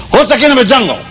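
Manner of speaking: high, loud lion roar of a question; hammed-up nasty lion challenge. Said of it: "the lion was very nasty".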